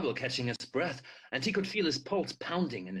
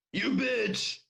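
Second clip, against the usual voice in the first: sharp, higher angry shout; shouted insult; 8 semitones higher than usual. Acted. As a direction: spit the insult at her. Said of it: "Dead Dale Pearson shouted".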